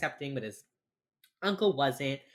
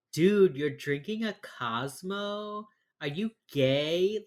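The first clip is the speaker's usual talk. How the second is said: slow, higher-pitched incredulous mock; mocking macho disbelief; 4 semitones higher, much slower than usual. Host parodying straight men who police drinks, mock-incredulous.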